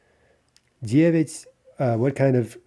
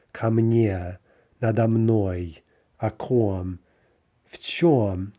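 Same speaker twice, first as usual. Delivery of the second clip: ordinary delivery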